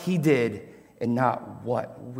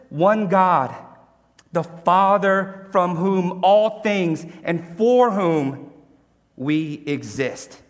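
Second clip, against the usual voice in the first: higher, louder fiery preaching; full-force preaching; 6 semitones higher, 4 dB louder than usual. Real, not acted. Preacher driving home to the church there is only one God, fiery. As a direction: preach it with fire and conviction.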